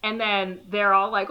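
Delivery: ordinary talk, nothing marked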